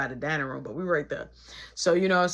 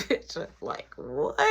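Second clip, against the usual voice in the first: ordinary talk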